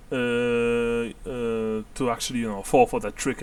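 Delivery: usual speaking voice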